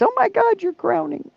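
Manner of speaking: very high mock shriek of surprise; shocked nurse. Nurse discovering the baby crowning, high mock shock. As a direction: squeal it like a startled nurse. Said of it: "she says".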